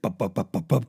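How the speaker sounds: mock rattling engine thump; engine-belt noise. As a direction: thump it out like a loose belt slapping. Imitating a loose engine belt.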